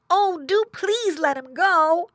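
high, loud, drawn-out begging; pleading boy pig. A narrator's reading. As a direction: plead slowly for your brother.

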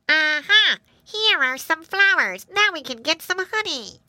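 high, excited little bee voice; crying bee. A narrator's reading. Bee spots flowers on the bonnet, crying out. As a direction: call out high to the swarm. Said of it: "a voice cried".

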